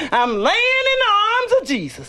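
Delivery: rising high, fervent church testimony; testifying. Retold or imitated. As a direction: soar up to Jesus.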